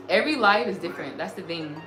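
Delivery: normal talking voice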